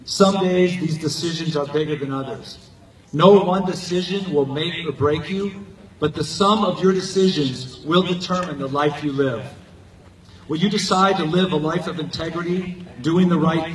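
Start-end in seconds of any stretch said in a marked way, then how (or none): none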